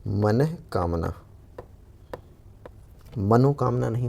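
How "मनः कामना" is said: मनः कामना slow, with the visarga sounded after मनः; careful pronunciation. Shown as a demonstration. Teacher to students, slowly sounding out मनः कामना. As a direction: stress the मनः so they hear it. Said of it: "होती है".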